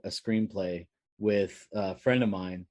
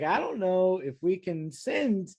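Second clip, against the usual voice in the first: a hint of an unsure clerk drawing it out; slow, higher hesitant clerk; 8 semitones higher, slower than usual. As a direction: stall it slowly, up high. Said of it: "she's like".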